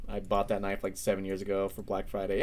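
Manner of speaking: ordinary talk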